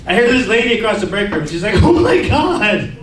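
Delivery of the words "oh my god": gossiping coworker. Retold or imitated. Coworker spreading break room gossip, mock-scandalized. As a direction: draw out the shock, pitched way up.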